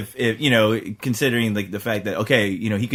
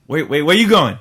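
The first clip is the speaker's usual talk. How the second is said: higher and louder, climbing into a mock plea; pleading with the screen; 12 semitones higher, 3 dB louder than usual. A frustrated viewer begs the hero to come back and fight, high, loud, mock pleading. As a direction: call after him, begging him to fight.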